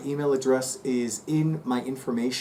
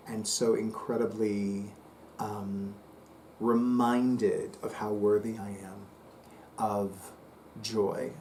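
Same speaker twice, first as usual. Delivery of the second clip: softer, slowing affirmation litany; gratitude affirmation; 5 semitones lower, 8 dB quieter than usual. Acted. Psychic reciting thanks like a soothing affirmation.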